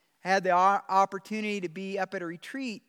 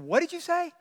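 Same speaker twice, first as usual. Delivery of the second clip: surprised question; 8 semitones higher than usual. Retold or imitated. Startled reply to stranger.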